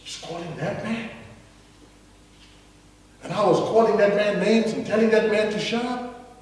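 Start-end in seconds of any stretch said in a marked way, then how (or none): 0.0-1.1 softer amazed hush; awed murmur. Acted. Crowd amazed that Jesus calls the beggar, hushed awe. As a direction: murmur it in disbelief. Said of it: "now they said".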